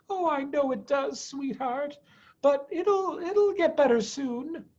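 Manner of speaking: a wife soothing her hurt husband; tender comfort. A narrator's reading. Mrs Fox comforts her husband.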